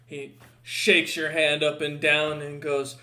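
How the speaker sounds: ordinary talk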